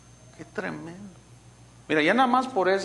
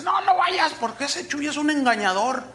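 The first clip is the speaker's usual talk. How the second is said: high-pitched imitation of an urgent warning; mock alarmed warning; 8 semitones higher than usual. Pastor warning member off the preacher's event, mock alarmed. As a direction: warn them off in a raised mimicking voice.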